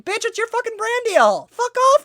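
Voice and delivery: exasperated outburst. Real, not acted. Ranting at Valkyrae's complaint, exasperated and loud. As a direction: snap at her, fed up.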